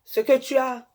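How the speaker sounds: impassioned sermon voice; fervent preaching. Acted. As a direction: preach it at the women like a high priestess. Preaches to women about marriage.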